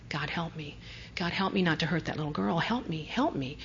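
soft, pleading prayer under the breath; hushed prayer. Retold or imitated. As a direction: murmur it like a desperate prayer. Retold desperate prayer.

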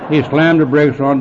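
ordinary delivery

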